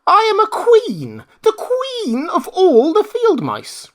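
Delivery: very high, slow, proud squeak; indignant mouse queen. Acted. Mouse queen declares her rank to the Woodman, proud squeak. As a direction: draw yourself up as a tiny queen.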